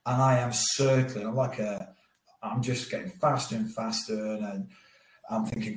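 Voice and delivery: ordinary talk, nothing marked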